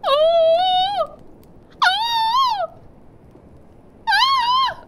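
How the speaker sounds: sudden shrill cries of fright; startled high yelps. Real, not acted. Gamer caught off guard, startled high yelps. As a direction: yelp high and sharp at a jump scare.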